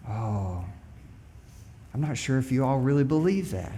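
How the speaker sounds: normal talking voice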